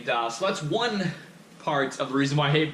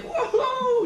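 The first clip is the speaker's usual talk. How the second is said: squealed whoa, far higher, with a laugh; mock whoa; 3 dB louder than usual. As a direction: yelp it like a startled bystander, laughing. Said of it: "and be like".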